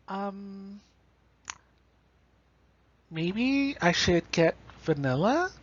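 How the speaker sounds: slow, hesitant, trailing "um"; hesitant, unsure. Shown as a demonstration. Teacher demos a timid choice, slow and hesitant. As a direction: hem and haw like you can't decide. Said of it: "it doesn't sound very confident".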